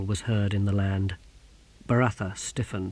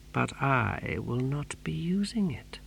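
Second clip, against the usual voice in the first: slow, whispered threat; quiet menace; 2 semitones higher, 7 dB quieter than usual. A narrator's reading. Exquisitor correcting the captain about the harpoon, slow menacing whisper. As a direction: whisper it slowly and deliberately.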